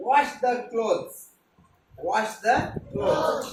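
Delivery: very slow, stretched words; slow teaching drill. Shown as a demonstration. Teacher drilling the phrase slowly for pupils. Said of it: "clothes म्हणजे कपडे".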